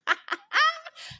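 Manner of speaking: bright high burst of laughter. Real, not acted. Amused at her own house dream, high laugh.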